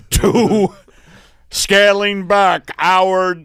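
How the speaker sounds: slow, loud corporate ad; ad read. Acted. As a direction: read like a commercial. Corporate ad parody.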